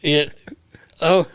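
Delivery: high, slow mock searching that rises into disbelief; retold astonished realization. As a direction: act out spotting them, pitch climbing. Acting out someone spotting friends onstage.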